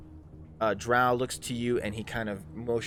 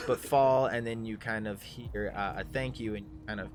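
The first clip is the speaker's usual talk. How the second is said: usual speaking voice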